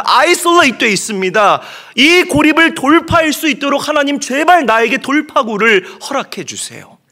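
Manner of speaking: loud, high pleading cry to God; desperate prayer. Acted. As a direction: beg God for a way out, all-out. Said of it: "하갈이 이런 마음이었던 거예요". (광야에서 하나님께 부르짖는 간절한 기도)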